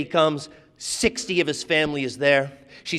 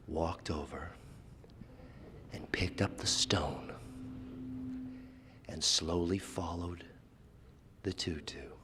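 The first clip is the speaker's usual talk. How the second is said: hushed; 10 semitones lower, 16 dB quieter than usual. A narrator's reading. Hushed ending of the story.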